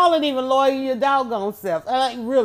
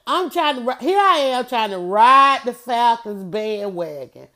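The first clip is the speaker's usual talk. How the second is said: usual speaking voice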